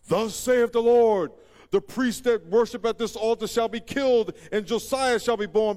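loud, high mock-prophetic declaration; prophet's proclamation. Acting prophet proclaiming in the street.

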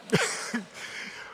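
high, soft laugh. Real, not acted. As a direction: chuckle at your own remark. Laughs after his own remark.

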